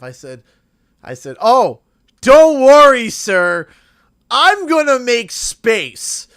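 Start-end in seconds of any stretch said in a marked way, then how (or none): 1.4-6.3 sarcastic politeness. Retold or imitated. Sarcastic polite reply to driver.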